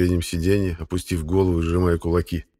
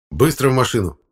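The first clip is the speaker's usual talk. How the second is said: loud, raised-pitch command; commanding order; 6 semitones higher, 5 dB louder than usual. A narrator's reading. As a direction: order a kid brother off at once. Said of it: "скомандовал я Серёжке".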